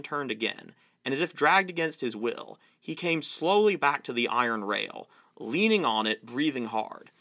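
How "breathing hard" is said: ordinary delivery, nothing marked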